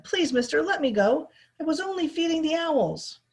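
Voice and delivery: put-on high, pleading kid voice; pleading boy. A narrator's reading. Roy begging the foreman to let him go, pleading. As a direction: beg to be let go.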